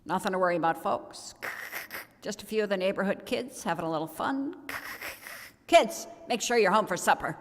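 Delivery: lightly voiced pilot on the PA; father on the loudspeaker reassuring tourists; calm loudspeaker announcer. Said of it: "come over the loudspeaker and say".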